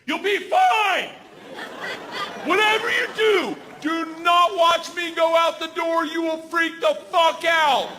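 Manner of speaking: high, forceful imitated yell; yelling over engines. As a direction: shout instructions over a roaring plane engine. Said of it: "she starts yelling at me".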